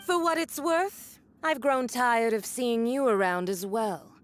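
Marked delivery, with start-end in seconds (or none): none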